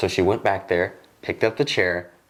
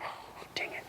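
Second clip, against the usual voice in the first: muttered under breath in a whisper; a hushed, dreading aside; 19 dB quieter than usual. Retold or imitated. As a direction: whisper it so she can't hear. Dreading the boss's mom.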